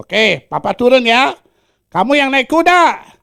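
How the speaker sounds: loud, high fatherly voice. Acted. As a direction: coax the son onto the horse.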